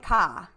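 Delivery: "ka" with the R dropped, Boston style. Shown as a demonstration. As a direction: say "ka" for car, no R. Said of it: "Boston is just, like, the R's".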